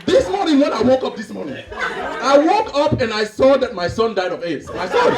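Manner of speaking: African man telling worse news emphatically; mock-tragic African storyteller accent. Father tells brother his son died, mock-grave African accent. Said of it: "Two Africans that haven't seen each other".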